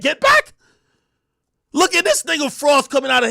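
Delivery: angry streamer yelling at a rapper's claims; heated rant. Real, not acted. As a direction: shout her down, fired up.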